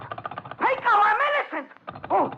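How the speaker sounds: very high desperate outcry; panicked shout. Acted. Hunted man calling to Pinko for mercy, panicked shout. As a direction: cry out your innocence in terror. Cries out to approaching gangster.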